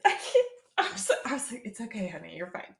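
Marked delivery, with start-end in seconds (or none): 0.1-1.1 imitated high, choked stammer; speechless embarrassment. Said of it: "Did he cry?". 1.7-2.8 soft, tender reassurance; gently calm a mortified client; soothing. Retold or imitated. Therapist soothing embarrassed client.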